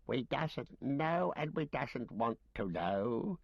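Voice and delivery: high, slow creature whimper; a cowering wretch whimpering; whimpering Gollum. A narrator's reading. Gollum cowering before Faramir, whimpering slowly. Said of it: "he whimpered".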